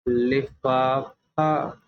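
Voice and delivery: लिफाफा stretched very slowly, each syllable long; drawn-out word. Shown as a demonstration. Teacher stretches लिफाफा slowly for young pupils to hear. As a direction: drag out लिफाफा for the class. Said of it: "और मैं ये word बोलूँगा".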